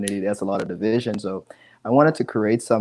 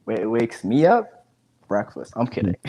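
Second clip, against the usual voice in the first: usual speaking voice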